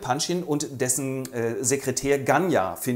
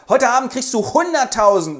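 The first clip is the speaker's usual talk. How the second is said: loud, higher showy offer; brash bidding; 10 semitones higher, 7 dB louder than usual. Acted. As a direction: fling money around. Rogoschin überbietet.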